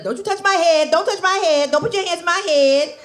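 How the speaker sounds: high, loud scolding don't-touch voice. Retold or imitated. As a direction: snap it out like a woman guarding her hair.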